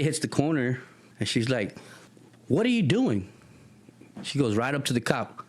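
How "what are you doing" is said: high-pitched acted outrage; indignant challenge. Kathy coming round the corner on police, indignant high challenge. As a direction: demand an answer in a raised pitch.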